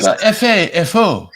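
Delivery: F A F O with a mock French accent. Shown as a demonstration. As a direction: say F A F O like a Frenchman, hammed up. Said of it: "I say it with a French accent".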